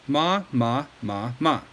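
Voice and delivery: ma ma ma ma in first, second, third, fourth tone; four Mandarin tones. Shown as a demonstration. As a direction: run through the four tones on ma. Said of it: "four different ways to say the word ma".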